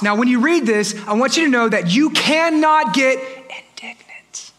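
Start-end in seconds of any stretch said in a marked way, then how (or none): 3.2-4.5 tiny whispered "indignant". Shown as a demonstration. Showing how not to be indignant.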